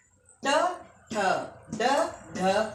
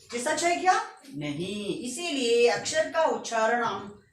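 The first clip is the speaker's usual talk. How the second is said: usual speaking voice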